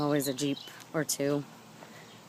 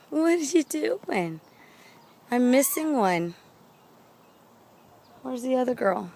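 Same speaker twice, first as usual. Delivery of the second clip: talking to pets; 8 semitones higher, 7 dB louder than usual. Real, not acted. Talking to chickens.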